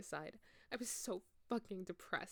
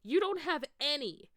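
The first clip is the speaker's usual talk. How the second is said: higher, louder furious shout; mad yell; 8 semitones higher, 10 dB louder than usual. Acted. Ebony yelling at Snape, loud and furious. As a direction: yell it in a rage. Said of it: "I yelled in madly".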